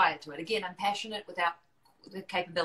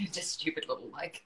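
normal talking voice

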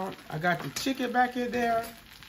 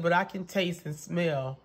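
ordinary talk, nothing marked

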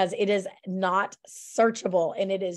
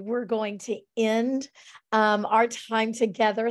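normal talking voice

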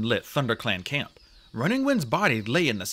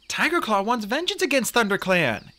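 raised, urgent report; 9 semitones higher than usual. A narrator's reading. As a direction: report it urgently in a higher pitch. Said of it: "he reported".

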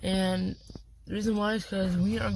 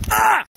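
sharp high startled shout; sudden yelp; 11 dB louder than usual. Real, not acted. Sudden outburst by campfire.

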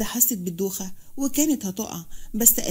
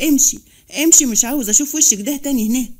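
loud, forceful order to leave; narrated outburst, hard on the repeated command; angry dismissal; 2 semitones higher, 7 dB louder than usual. A narrator's reading. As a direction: throw them out with a raised voice. Throws her out of the room.

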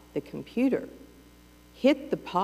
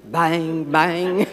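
loud, laughing bang bang; imitated pan bangs; 2 semitones lower, 6 dB louder than usual. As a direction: mimic pans being slammed.

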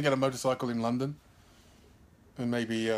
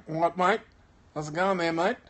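higher-pitched playful Australian greeting; Australian accent; 4 semitones higher than usual. Pretend. Greeting Australian viewers in a put-on Australian accent. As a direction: put on an Aussie accent, lifting the last word. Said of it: "don't know how to do a Philippine accent".

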